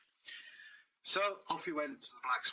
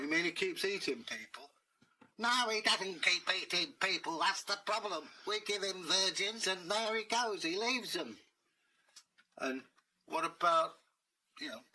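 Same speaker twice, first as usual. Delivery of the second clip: fed-up village master; 6 semitones higher than usual. Acted. Village master complaining to Dave about the dragon. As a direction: grumble about the dragon, pitched up.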